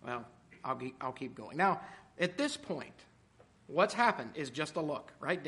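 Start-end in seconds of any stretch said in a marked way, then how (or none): none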